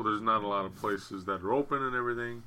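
ordinary talk, nothing marked

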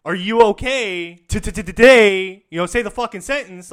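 loud mocking stutter, pitch up; mocking shout. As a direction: stutter at him to make the joke, loud.

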